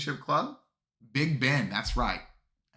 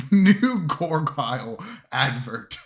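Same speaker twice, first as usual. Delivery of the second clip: slow, loud words through laughter; laughing; 4 semitones higher, 8 dB louder, slower than usual. Real, not acted. Streamer jokes about his gargoyle clip, laughing. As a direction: chuckle it out while amused.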